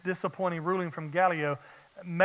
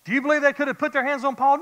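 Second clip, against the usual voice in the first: higher, louder, fast challenge; fired-up preaching; 7 semitones higher, 6 dB louder, faster than usual. Acted. Preacher challenging the congregation, fast and fired-up. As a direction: push the question at them fast.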